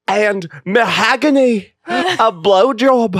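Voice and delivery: drawn-out Scottish storytelling; slow, hammed-up Scottish brogue; Scottish accent. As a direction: stretch it out in a mock Scottish accent. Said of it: "That's Scottish".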